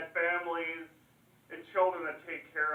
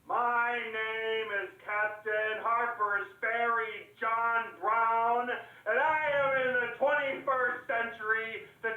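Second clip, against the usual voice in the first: slow, higher-pitched proclamation; declaimed oratory; 4 semitones higher, slower than usual. Acted. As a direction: announce yourself like a stump orator. Orator proclaims himself to crowd.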